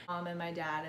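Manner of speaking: ordinary talk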